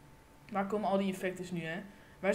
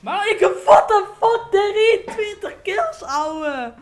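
much higher and much louder, stretched out slowly; triumphant yelling; 9 semitones higher, 16 dB louder, much slower than usual. Real, not acted. Gamer bragging to viewers about kills, triumphant shout. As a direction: roar the kill count in triumph.

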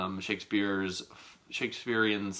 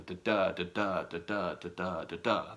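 "da, da, da" sing-song with a hammered beat on every second "da"; da-da rhythm demo; 3 semitones lower than usual. Shown as a demonstration. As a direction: tap out the iambic beat, unstressed then stressed. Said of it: "when poorly read, should sound like".

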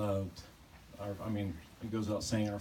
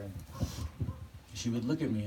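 normal talking voice

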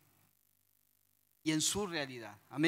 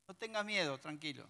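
much softer, gentle soothing; calming reassurance; 3 semitones lower, 9 dB quieter than usual. A narrator's reading. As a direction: settle a frightened servant. Eliseo calma a su criado. (Eliseo calma al criado en voz suave)

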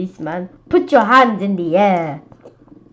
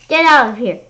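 yelled; 4 semitones higher, 8 dB louder than usual. A narrator's reading. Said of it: "I yelled".

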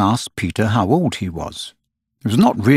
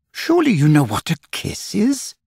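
higher, incredulous question; stunned disbelief; girl astonished he doesn't know; aghast; 9 semitones higher than usual. A narrator's reading.

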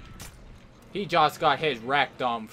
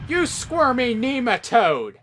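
mock yell; 10 semitones higher, 6 dB louder than usual. Shouted insult at enemy.

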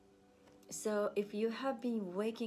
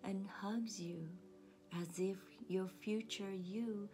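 soft, slightly lowered meditation voice; guided meditation; 6 dB quieter than usual. Acted. Counselor leading listeners to picture themselves as a child, soothing meditation voice. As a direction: guide them gently, leaving long pauses between images.

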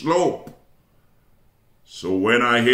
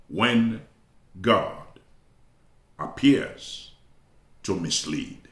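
pauses after "when", "God" and "appears"; deliberate title refrain; 5 semitones lower, 4 dB quieter, slower than usual. Real, not acted. Preacher repeating his sermon title to the congregation, slow and pause-laden. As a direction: land each piece separately, "to mislead" low and soft.

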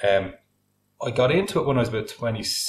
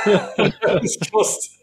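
laughing; 11 semitones higher, 4 dB louder, much slower than usual. Real, not acted. Laughing after story beat.

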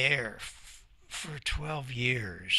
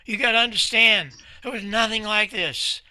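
louder and higher, pressing the point; emphatic insistence; 9 semitones higher, 5 dB louder than usual. Real, not acted. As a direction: make them grasp how big it was.

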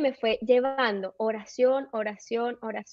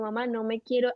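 usual speaking voice

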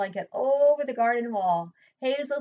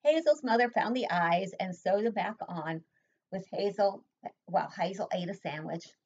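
ordinary delivery

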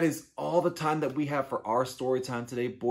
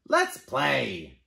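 eager bird voice; 3 semitones higher, 6 dB louder than usual. Acted. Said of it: "said the bird".

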